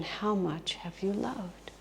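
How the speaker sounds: very soft, slow question; hushed, serious asking; Jesus asking tenderly. Retold or imitated. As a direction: let the question fall gently and slowly.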